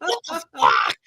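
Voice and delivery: very high squawking imitation of a quarrel; hammed-up indignation, voice way up; mock incredulous outrage. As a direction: protest in a high, outraged mimicry. Acted backstage quarrel.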